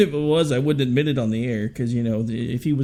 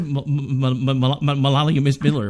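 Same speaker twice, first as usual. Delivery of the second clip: mock stutter. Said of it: "do you have a stuttering problem".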